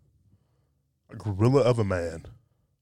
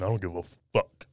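normal talking voice

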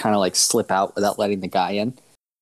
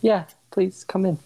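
welcoming shopkeeper; 11 semitones higher than usual. Acted. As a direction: gently usher the guests in. Shopkeeper eagerly inviting guests in.

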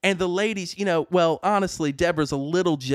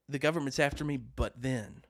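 paranoid hush; 5 semitones lower, 8 dB quieter than usual. Retold or imitated. Paranoid rant again.

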